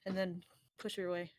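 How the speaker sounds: usual speaking voice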